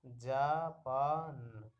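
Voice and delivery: Japan drawn out very slowly, each sound held; slow blended reading. Shown as a demonstration. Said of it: "तो हो गया".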